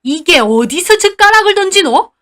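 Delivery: high, loud enraged dialect scolding; furious grandma. Acted. Grandma rages at granddaughter for throwing chopsticks, furious shout. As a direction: shriek at the child like an angry grandma. Grandma raises hand to hit.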